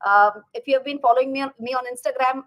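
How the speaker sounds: ordinary talk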